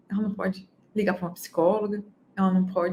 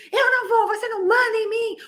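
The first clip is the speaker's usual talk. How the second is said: put-on much higher, whiny defiant kid; defiant child; 11 semitones higher than usual. Daughter defies her mother, much higher and tearful. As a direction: squeal back like a rebellious little girl.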